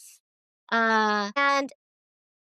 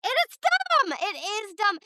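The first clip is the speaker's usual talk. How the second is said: emphatic outburst; 8 semitones higher than usual. Real, not acted. Cutting off the rant with a high exasperated cry. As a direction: fling out dumb in sudden exasperation. Heated agreement about pushy chaplaincy.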